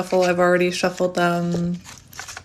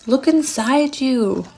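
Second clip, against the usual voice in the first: slow, high, drawn-out mystical voice; playful, hammed-up sage impression; Grandmother Willow; 5 semitones higher, 3 dB louder, slower than usual. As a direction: stretch it out like a wise storybook spirit. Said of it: "I'm hearing Grandmother Willow from Pocahontas".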